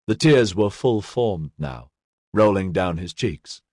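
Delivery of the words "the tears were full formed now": ordinary delivery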